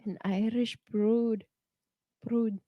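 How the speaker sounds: soft, slow attempt at Irish; Irish accent attempt. Shown as a demonstration. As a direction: carefully copy an Irish brogue. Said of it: "hear it and then repeat it".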